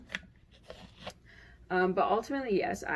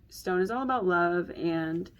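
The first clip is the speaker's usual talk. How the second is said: ordinary delivery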